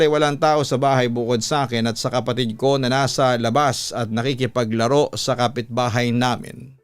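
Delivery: ordinary delivery, nothing marked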